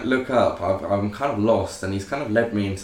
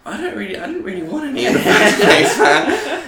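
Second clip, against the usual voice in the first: laughing through the words, pitched up; nervous laughing; 13 semitones higher, 7 dB louder than usual. Real, not acted. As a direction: laugh nervously as you protest. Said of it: "laugh heard".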